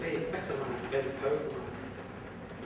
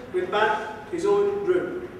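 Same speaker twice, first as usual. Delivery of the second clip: plain reading voice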